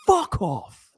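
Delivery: a high yelled insult, half-joking; mock angry shout. Telling off imagined Spanish drinkers, mock high yell. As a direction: throw a pretend high curse in their faces. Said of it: "flip them in their faces and say".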